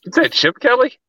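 surprised question. Real, not acted. Cohost mixes up coach names.

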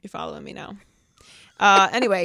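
ordinary talk, nothing marked

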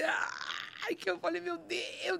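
high, hushed strained exclamation; suppressed scream. Retold or imitated. Cannot wake the family, high stifled cry. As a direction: hold the cry back, high and soft. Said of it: "eu não podia gritar".

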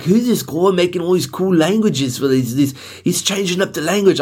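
high-pitched British onlooker; mock British accent. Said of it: "They'd be like".

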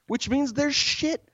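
high, slow, horrified exclamation; appalled disbelief. Real, not acted. Telling Dan about the door handle, high appalled outrage.

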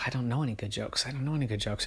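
soft, hurried thought to oneself; worried thinking. Acted. Koala thinking he has no jokes, soft worried aside. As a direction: say it under the voice, softly. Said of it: "the koala was thinking".

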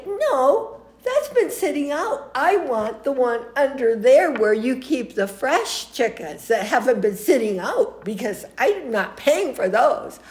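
high, emphatic refusal, not quite rude; firmly in charge. Retold or imitated. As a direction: imitate a commanding shopper laying down terms. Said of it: "She wasn't exactly rude, but she also was in charge".

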